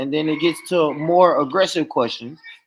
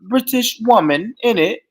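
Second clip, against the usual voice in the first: mock London slang, fast and a bit higher; mock British slang; 5 semitones higher than usual. Teasing Randy for picking up London slang, mock accent. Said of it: "if I start saying".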